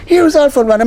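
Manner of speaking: mock proud lady, pitched far up; proud announcement. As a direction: present him like a big surprise. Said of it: "she say".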